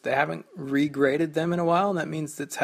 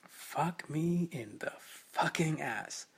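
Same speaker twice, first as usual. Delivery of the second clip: softer drawn-out curse; quiet slow curse; 9 dB quieter, slower than usual. Retold or imitated. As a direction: murmur the curse slowly. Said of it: "of exclamation".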